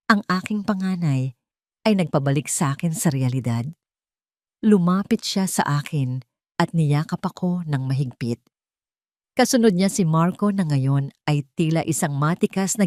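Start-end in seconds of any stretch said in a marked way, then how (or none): none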